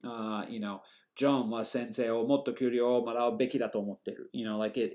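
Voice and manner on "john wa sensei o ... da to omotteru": "John wa sensei o motto kyuryo..." quick and even, no stresses; every syllable of "John wa sensei..." equally weighted; monotone mora timing. Shown as a demonstration. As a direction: rattle the Japanese sentence off in a monotone, from "John wa" to "omotteru". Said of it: "so it sounds monotone or moritimed".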